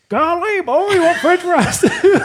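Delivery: put-on high, slow, laughing old-timer voice; golly boy, squeaky and amazed; shocked grandparent. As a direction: play the stunned grandma, drawn out and high. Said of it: "And they're like".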